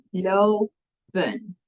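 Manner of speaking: "Lopen" split into lo, pause, pen; two separate syllables. Shown as a demonstration. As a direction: clap out "Lopen" in two beats. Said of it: "it's two".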